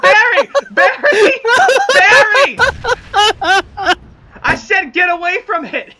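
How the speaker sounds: loud, high warning yell; frantic shouting. Real, not acted. Player warning Barry off, frantic shout.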